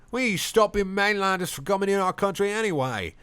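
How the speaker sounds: high put-on drunk bloke; drunk man voice. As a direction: sneer it like a pub drunk. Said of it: "says the man".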